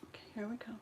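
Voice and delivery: soft whispered cue. Real, not acted. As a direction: whisper it before the prayer. About to recite prayer.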